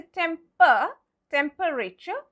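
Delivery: TEMPER with a full UH, then TEMPERATURE as tem-per-ay-cher; pronounced E. Shown as a demonstration. Teacher warns against it, TEMPERATURE as tem-per-ay-cher. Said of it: "We don't say".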